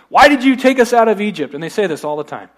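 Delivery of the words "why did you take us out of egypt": loud, high mock grumble; complaining. Israelites complaining to their leader about Egypt, loud mock complaint. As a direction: imitate a crowd griping, raised voice. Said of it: "they complained, and they said".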